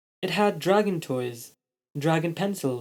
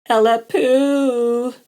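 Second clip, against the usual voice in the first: high, slow cartoon dragon; 9 semitones higher, 7 dB louder, much slower than usual. A narrator's reading. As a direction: stretch it out like a silly creature. Dragon speaks.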